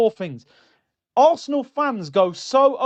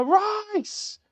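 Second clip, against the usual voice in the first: high mock goal yell of a name; mock celebration shout; 13 semitones higher than usual. Fan mocking a goal celebration, a high mock yell. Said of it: "you don't hit a ball and go".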